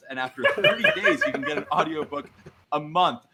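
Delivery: hammed-up laughing sponsor pitch; mock ad read. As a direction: oversell the offer, half laughing. Jokey fake sponsor ad continues.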